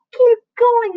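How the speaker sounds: high, loud cheer of encouragement; crying out. A narrator's reading. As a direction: urge him on. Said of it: "they cried".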